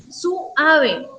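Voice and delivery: "suave" said as su, ave with a break; split diphthong. Shown as a demonstration. Teacher mocking a wrong reading, vowels split. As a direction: separate the u from the a in "suave". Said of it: "Yo no digo así".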